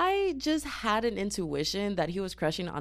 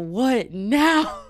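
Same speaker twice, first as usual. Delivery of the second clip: laughing disbelief, pitch higher and louder; 6 semitones higher, 6 dB louder than usual. Real, not acted. Teller laughing at her friend's bold suggestion. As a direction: crack up mid question.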